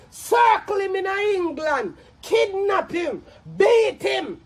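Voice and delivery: slow, high, punched-out commands; emphatic angry list; angry shouted orders. Real, not acted. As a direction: shout each order separately, drawing it out. Lists orders in angry rant.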